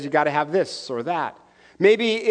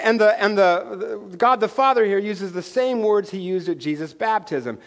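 ordinary talk, nothing marked

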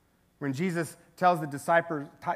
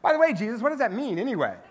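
excited, higher, laughing eager disciple; 4 semitones higher, 4 dB louder than usual. Retold or imitated. Disciples quizzing Jesus, excited and higher, laughing. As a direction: play a giddy follower peppering questions. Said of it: "you can kinda see the disciples going".

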